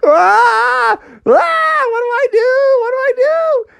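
very high, loud mock panic; screaming panic. Froggy falling from the sky on a goose, panicking. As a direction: shriek helplessly in falsetto.

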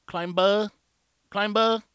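"climber" with a hard, punched B and open ending; overemphasized B. Retold or imitated. Imitating English learners who overemphasize the B, hard-B "climber". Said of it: "they'll overemphasize the B".

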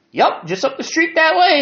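put-on very high-pitched boy answering; reply up high like a local kid; young boy's voice. Blonde boy tells hiker where he lives, high child voice.

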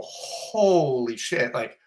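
slow stunned start, then a loud whoa breaking into a laugh; rattled and stunned, blurting it out, then laughing; shocked outburst. Retold or imitated. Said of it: "I go".

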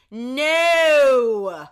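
a loud, teasing flat refusal; playful loud no. Pretend. Answering a viewer's party request with a loud mock no. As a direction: bark a joking no at a viewer.

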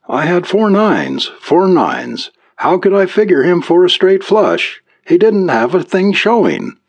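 dismayed youngster. A narrator's reading. Youngster telling his mother about the poker loss, aggrieved. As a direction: complain the hand was unbeatable. Youngster lamenting lost hand.

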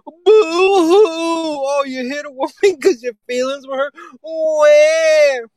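high fake crying, shouted; mock sobbing in a high voice; mocking boo hoo. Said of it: "I faked a bunch of crying sounds".

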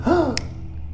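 sharp, high mock gasp; shocked gasp. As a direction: gasp as if discovering a wound. Acting shock at finding a wound.

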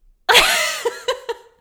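loud squealing laugh; high burst of laughter. Real, not acted. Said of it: "I'm laughing because".

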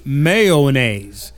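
mayonnaise drawn out as MAY-oh-naise; three-syllable mayonnaise. Shown as a demonstration. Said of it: "Three syllables".

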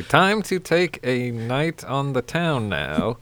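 normal talking voice

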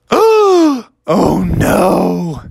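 high, loud dismayed cry. Acted. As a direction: react in horror. Sees teeth in dirt.

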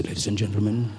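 very low, measured cockpit announcement; pilot's wide voice. Acted. Pilot coming on to address passengers, wide low voice. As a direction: do the deep, unbothered airline pilot. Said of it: "comes on with that very wide voice".

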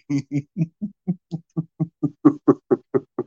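high giggling fit; real laughter. Real, not acted. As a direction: burst out laughing. Laughing at own impression.

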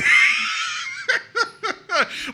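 a high, shouting laugh that bursts out. Real, not acted. Laughing hard at the story.

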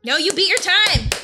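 excited. Real, not acted. Excited announcement of result.

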